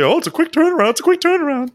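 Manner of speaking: high-pitched whiny mimicry of a complainer; exaggerated high mock moaning; mock complaint. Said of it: "That's usually".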